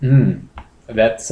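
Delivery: ordinary talk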